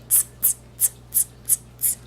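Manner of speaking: soft whispered tss tss; whispered mocking tss sounds; monkey tsk-tsk. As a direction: tut back at him like monkeys. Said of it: "and said".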